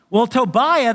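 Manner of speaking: usual speaking voice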